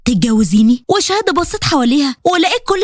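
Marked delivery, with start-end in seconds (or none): 0.0-0.8 low male voice for the proposal; a hint of a man proposing; lightly voiced Qasim. Qasim proposing to Shahd before everyone, low and earnest. Qasim asks her to marry him.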